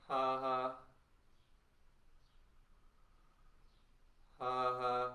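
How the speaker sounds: short punched ha sounds; touch sound with a ha; vocal warm-up ha. Shown as a demonstration. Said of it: "As you touch sound".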